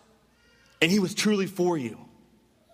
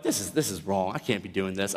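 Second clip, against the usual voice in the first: low, softer inner thought; muted self-reproach; 12 semitones lower, 5 dB quieter than usual. Retold or imitated. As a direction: lower the voice as if thinking aloud. Retells his guilty inner thought.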